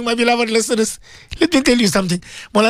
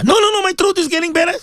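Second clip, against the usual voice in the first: quick protest; 9 semitones higher, faster than usual. Real, not acted. Answering a listener.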